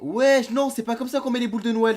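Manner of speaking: loud mock scolding of the kid. Youtuber scolding a kid in a clip, loud mock scolding.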